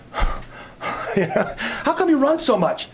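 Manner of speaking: slow, high, laughing through the question; laughing repeat. Retold or imitated. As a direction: drag it out while laughing.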